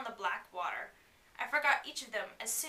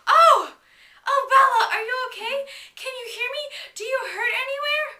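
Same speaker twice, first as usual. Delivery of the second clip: high, loud, breathless worry; frantic relief; 12 semitones higher, 12 dB louder than usual. Acted. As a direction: cry out in panicked relief. Jacob's frantic relief at Bella.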